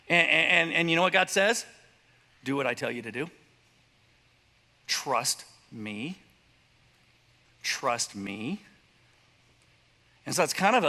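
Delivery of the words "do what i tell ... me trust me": lower, softer, steady God voice; calm God voice. Retold or imitated. God answering the afraid believer, low and calm. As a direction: settle them with quiet authority.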